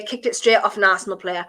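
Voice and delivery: ordinary talk, nothing marked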